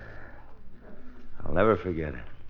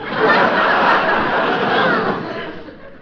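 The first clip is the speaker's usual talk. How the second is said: high giggle; 7 dB louder than usual. Acted. Phil laughs in argument.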